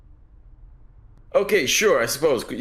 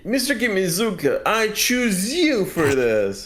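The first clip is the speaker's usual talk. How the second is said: reporter voice; 8 semitones higher, slower than usual. Acted. Voicing the reporter character.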